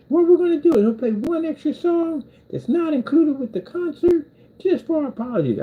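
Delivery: high, hammed-up rock frontman; hammed-up stage announcer. Band singer apologising to the crowd for the wrong city, hammed-up high call.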